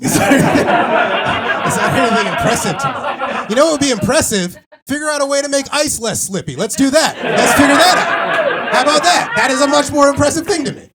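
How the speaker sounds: high, loud, fast rant; building rant. Real, not acted. Rising rant about AI.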